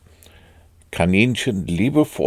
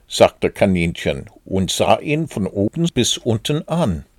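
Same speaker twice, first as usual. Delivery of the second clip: plain reading voice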